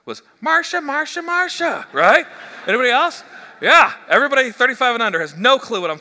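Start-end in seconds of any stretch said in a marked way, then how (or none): 0.4-1.9 high, whiny Marcia Marcia call; Brady Bunch whine. Retold or imitated. Pastor mimicking the sitcom sister's whiny call. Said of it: "this will date me".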